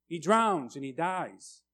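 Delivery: normal talking voice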